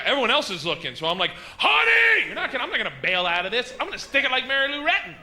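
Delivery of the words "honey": loud call. Retold or imitated. Calling wife in store.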